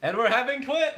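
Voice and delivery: high, bright fake big news; mock excited announcement. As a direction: announce it like a gender reveal. Fake announcement, then just kidding.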